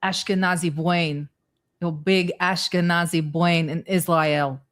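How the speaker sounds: a lightly put-on Israeli accent, Bwain for brain; trying out an Israeli accent, not quite there; attempted Israeli accent. Shown as a demonstration. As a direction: practice Israeli accent, mild. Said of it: "I'm working on my".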